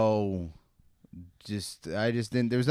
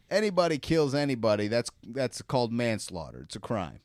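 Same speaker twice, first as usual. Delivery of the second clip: usual speaking voice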